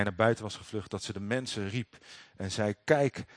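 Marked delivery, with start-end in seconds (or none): 2.8-3.2 a louder, higher call to the household; woman crying out to the household to look. Retold or imitated. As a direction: summon the servants with a sharp call. Woman calling out to servants.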